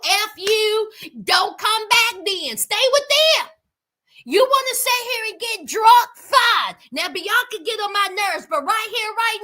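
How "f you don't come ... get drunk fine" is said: mock angry telling-off, getting louder; telling him off. Pretending to tell off drunk Daniel. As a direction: snap at him to stay with them. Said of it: "I would have told Daniel".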